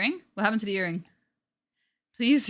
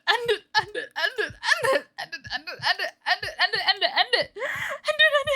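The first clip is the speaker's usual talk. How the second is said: high, broken laughter through the words; mad, laughing breakdown; manic laughing sobs; 12 semitones higher than usual. Acted. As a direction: crack into hysterical laughing crying.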